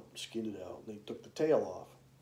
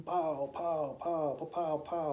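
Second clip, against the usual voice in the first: sharp higher pow pow gunshot sounds; imitated gunshots; 6 semitones higher, much slower than usual. Telling of the night shooting in the creek bottom, mimicking gunshots. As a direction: pop each pow like a distant rifle.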